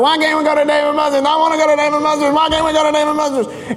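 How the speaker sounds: put-on high, fast whine of a little boy; whining child. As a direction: whine it out like a sulking kid. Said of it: "Then he starts whining about it".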